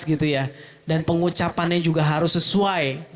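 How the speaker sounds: normal talking voice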